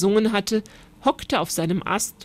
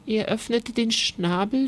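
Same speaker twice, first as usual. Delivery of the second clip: ordinary delivery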